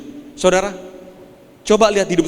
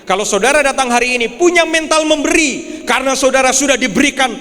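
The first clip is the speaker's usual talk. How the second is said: preaching at full force; 6 semitones higher than usual. Acted. Pastor urging the congregation to give, preaching at full force. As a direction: lift the room, press it home.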